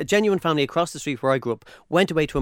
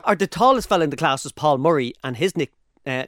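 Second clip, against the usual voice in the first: normal talking voice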